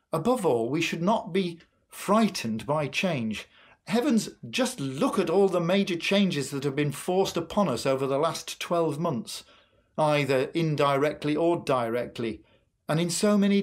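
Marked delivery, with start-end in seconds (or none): none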